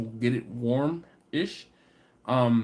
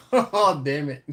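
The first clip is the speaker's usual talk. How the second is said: laughing; 3 dB louder, slower than usual. Real, not acted. Laughing at himself.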